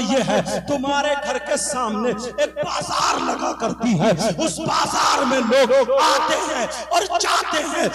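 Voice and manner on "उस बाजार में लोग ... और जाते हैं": raised, ringing sermon delivery; forceful preaching. Real, not acted. Preacher explains the lost blessings to the crowd, forceful preaching. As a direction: drive each phrase home to the congregation.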